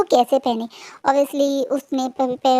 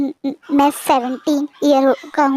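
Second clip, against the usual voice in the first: a touch nervous, stammering start; slower than usual. Acted. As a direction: shyly stutter out the answer. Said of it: "थोड़ा सा घबराते हुए बोलता है". (थोड़ा घबराते हुए, हकलाते हुए)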